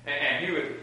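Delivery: ordinary talk, nothing marked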